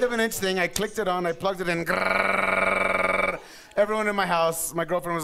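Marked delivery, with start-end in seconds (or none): 1.9-3.4 a rough buzzing grr like hair clippers; imitated clipper buzz.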